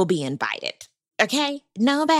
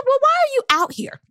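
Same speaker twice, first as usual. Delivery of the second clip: incredulous. Retold or imitated. Retelling her own reaction.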